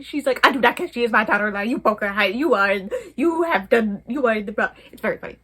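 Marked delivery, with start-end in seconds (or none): 0.5-4.7 stern mother. Retold or imitated. Mother insisting the girl is her daughter-in-law, loud mock scolding. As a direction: lay down the law like an outraged mother.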